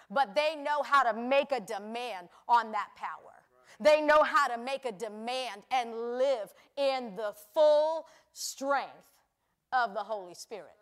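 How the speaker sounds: preaching. Acted. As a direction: drive the point home.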